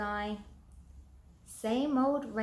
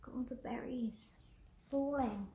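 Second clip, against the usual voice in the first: whisper; 2 semitones higher, 8 dB quieter than usual. Real, not acted. Reader whispering to a child about falling berries, hushed. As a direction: whisper it quickly, full of wonder.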